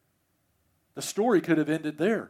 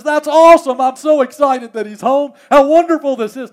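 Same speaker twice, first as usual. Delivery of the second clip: loud, high, over-the-top delight; mock excitement; 11 semitones higher, 18 dB louder than usual. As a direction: gush it at full volume, hammed up. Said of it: "No, he doesn't".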